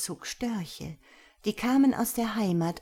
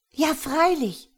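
higher, louder, slow eager yes; eager boy; 9 semitones higher, 7 dB louder than usual. A narrator's reading. Hjalmar agreeing to wear the uniform, higher and louder. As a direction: agree gladly like a small boy. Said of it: "sagte Hjalmar".

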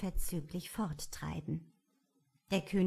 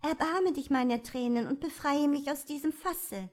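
higher, tearful pleading princess; 7 semitones higher, 3 dB louder than usual. A narrator's reading. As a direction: plead for mercy in a high voice. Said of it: "sagte die Prinzessin".

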